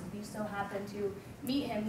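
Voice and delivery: ordinary talk, nothing marked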